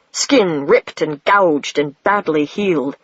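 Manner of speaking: plain reading voice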